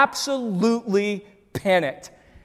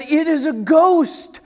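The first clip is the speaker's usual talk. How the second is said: loud, raised cry of alarm, read dramatically; crying out in fear; 7 semitones higher, 7 dB louder, slower than usual. Acted. Terrified disciples seeing a figure on the sea, crying out. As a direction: read it as a frightened outcry. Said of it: "they cried out in fear".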